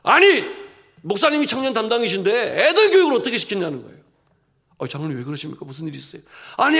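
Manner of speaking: elder scolding the youth pastor loudly. Retold or imitated. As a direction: tell the pastor off sharply. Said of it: "저를 혼내시는 거예요".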